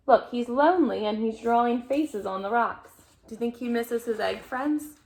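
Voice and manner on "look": ordinary delivery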